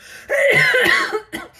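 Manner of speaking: loud, high hacking cough; choking cough. Real, not acted. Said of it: "I'm choking on a jalapeno".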